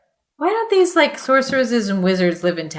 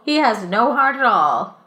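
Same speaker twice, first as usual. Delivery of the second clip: ordinary talk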